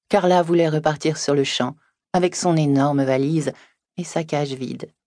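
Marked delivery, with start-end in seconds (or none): none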